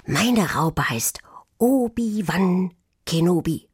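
slow whispered secret; hushed Star Wars whisper. A narrator's reading. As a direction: whisper the name slowly, mysterious. Quan whispering name.

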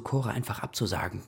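ordinary delivery

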